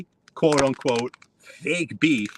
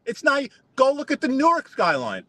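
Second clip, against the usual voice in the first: heated rant; 11 semitones higher than usual. Real, not acted. Ranting at rival Stiggs.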